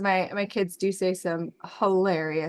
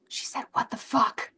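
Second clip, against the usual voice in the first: whispered quote; 3 semitones higher, 3 dB quieter than usual. Retold or imitated.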